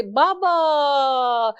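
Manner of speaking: very slow, stretched-out wail of بابا; drawn-out cry. Acted. Girl's last call to her father, very slow wail. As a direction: pull بابا out long like a last cry for help. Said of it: "آخری جو آواز تھی اس کی تھی".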